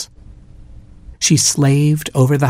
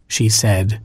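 ordinary delivery, nothing marked

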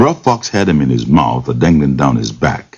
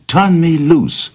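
much higher, slow, pleading cry to be let go; frightened cry; 13 semitones higher, slower than usual. Acted. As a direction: beg high and slow, stuck and scared. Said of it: "the rabbit cried".

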